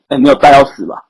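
ordinary talk, nothing marked

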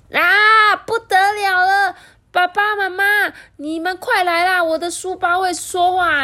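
very high, loud alarmed cry; panicked shout. Acted. Boy screaming for his parents that the bag talks, panicked.

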